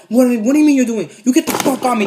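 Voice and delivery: high, loud mock outrage; imitated heated retort; angry comeback. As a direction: act out an indignant man lashing back. Man snapping back at cousin.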